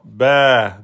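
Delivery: "Ba" held over two beats; long vowel. Shown as a demonstration. Teacher naming two beats, long "Ba". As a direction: stretch the vowel of "Ba". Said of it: "deux temps".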